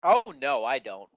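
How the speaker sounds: normal talking voice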